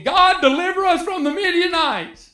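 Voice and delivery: high, pleading cry to God; pleading Israelites. Retold or imitated. Preacher voicing Israel begging God, high plea. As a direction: beg God for rescue like the desperate Israelites. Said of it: "They're asking".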